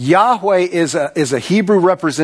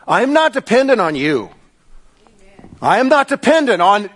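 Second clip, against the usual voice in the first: raised-pitch sermon declamation, long pauses between declarations; declamatory preaching as God; 4 semitones higher than usual. Acted. God tells Moses he depends on nobody, declamatory preaching. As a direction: proclaim each line as the Almighty.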